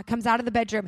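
usual speaking voice

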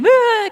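short high-pitched fake wail; mock cry. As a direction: put on a little boo-hoo.

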